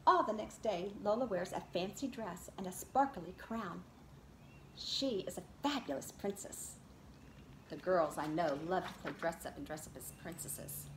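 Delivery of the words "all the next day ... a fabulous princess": lively read-aloud picture book voice; reading to children. Acted. Teacher reads Lola Loves Stories to her class, in a lively storybook voice. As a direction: read it to a circle of little kids.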